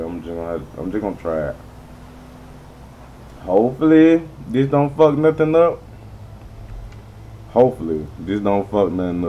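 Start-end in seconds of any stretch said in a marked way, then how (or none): 3.4-5.8 higher and louder, anxious hope; louder, jittery hoping; nervous hope. Real, not acted. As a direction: blurt it out, nervous and hopeful. Says the line twice.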